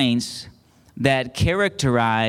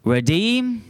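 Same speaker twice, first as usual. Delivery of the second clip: REDEEM with a high rising second syllable; raised, drawn-up REDEEM, inviting repetition; call-and-response; 7 semitones higher than usual. Real, not acted. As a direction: call out "Redeem" for the crowd to echo, lifting high on "deem". Leading congregation to repeat words.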